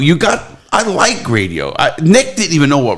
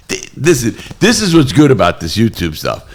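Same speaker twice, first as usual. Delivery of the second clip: ordinary talk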